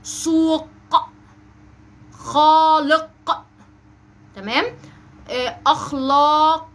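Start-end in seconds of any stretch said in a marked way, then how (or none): 0.0-3.1 long, raised "سوق، خالق" with q released apart; exaggerated final qaf. Shown as a demonstration. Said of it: "أنا بوضح حرف القاف إزاي". 5.7-6.7 slow "أخلاق", long vowel then popped q; exaggerated final qaf. Shown as a demonstration. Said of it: "أنا بوضح حرف القاف إزاي".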